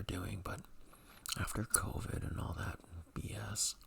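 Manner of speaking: soft ASMR whisper; breathy whisper to the mic. Real, not acted. Whispers all through.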